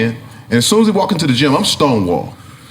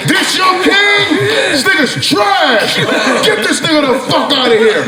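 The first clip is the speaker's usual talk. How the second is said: mocking shout; 13 semitones higher than usual. Player jeering the crowd after scoring, shouting.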